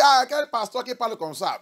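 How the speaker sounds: high, loud scoffing question; mocking listener's retort. Listener scoffs at the preacher's style, loud mock disbelief. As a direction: scoff like a shocked churchgoer. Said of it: "Ah, tu vas dire".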